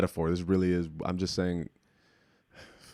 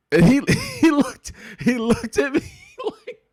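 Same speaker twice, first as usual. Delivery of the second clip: high laughter through the words; words cracking into laughter; laughing; 6 dB louder than usual. Real, not acted. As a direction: laugh while telling it. Laughing while telling.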